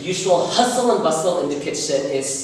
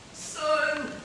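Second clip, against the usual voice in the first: weak plea; 10 semitones higher, 7 dB quieter, much slower than usual. Acted. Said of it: "my papa's voice".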